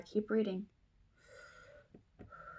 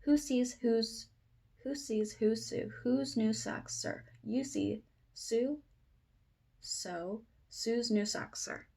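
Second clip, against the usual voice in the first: quicker tongue twister read; faster attempt. Shown as a demonstration. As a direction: pick up the speed through the tricky words. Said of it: "if I can say this again faster".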